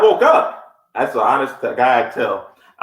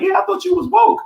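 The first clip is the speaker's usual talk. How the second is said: mock high woman's voice. Woman's reply quoted.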